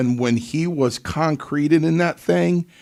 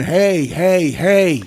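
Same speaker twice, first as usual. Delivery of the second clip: raised, higher calls of hey; calling out; 5 semitones higher, 3 dB louder, much slower than usual. Retold or imitated. Pat Patterson hurrying over, calling out hey. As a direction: call him back urgently.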